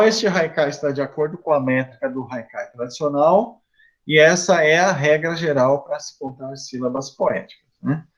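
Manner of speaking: normal talking voice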